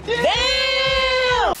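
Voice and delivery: long, high shouted damn; high full shout; loud yell. Real, not acted. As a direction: yell it out, drawn out. Yelling at the game.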